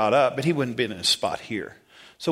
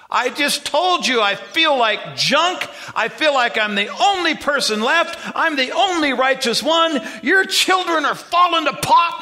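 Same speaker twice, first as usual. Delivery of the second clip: whining Elijah; 14 semitones higher, 8 dB louder than usual. Acted. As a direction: whine like a prophet feeling sorry for himself. Elijah complains to God, whining.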